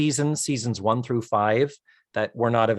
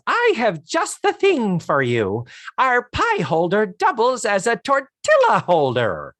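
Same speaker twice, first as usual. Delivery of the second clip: retold saleswoman; 7 semitones higher, 3 dB louder than usual. Charlotte Rae voiced.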